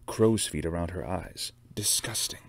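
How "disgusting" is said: ordinary delivery, nothing marked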